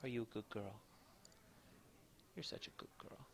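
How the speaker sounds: soft sing-song puppy talk; talking to a dog. Real, not acted. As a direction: coo praise at the sleepy dog. Praising puppy on camera.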